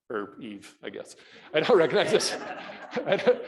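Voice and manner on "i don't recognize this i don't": laughing disbelief. Retold or imitated. To the class, laughing at not knowing her. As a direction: laugh at your own confusion.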